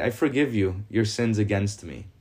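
normal talking voice

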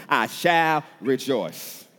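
extremely slow, loud declaration; emphatic, drawn-out proclamation; resolute answer. Acted. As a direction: stretch each word into a firm vow.

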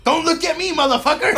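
high, loud laughing mock shout; mock yell at onlookers. Buddy yelling at staring passengers, loud high mock shout. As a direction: bark it at the crowd through laughter. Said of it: "he's telling fools".

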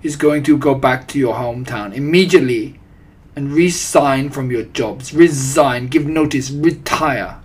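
loud, emphatic orders; accented witch dictating to followers; thundering. A narrator's reading. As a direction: hammer out each command. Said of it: "thundered the Grand High Witch".

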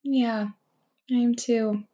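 ordinary talk

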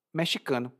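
usual speaking voice

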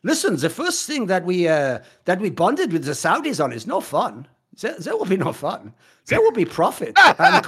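mock German corporate villain; solemn German-accented business pitch. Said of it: "I should start doing that German accent more".

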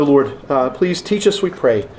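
normal talking voice

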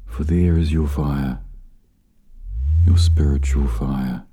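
slow, measured meditation guide with long pauses; spacious, calming delivery, letting each phrase settle; guided meditation. Real, not acted. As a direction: lead them gently inward, unhurried.

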